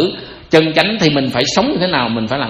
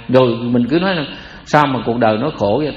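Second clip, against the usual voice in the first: ordinary talk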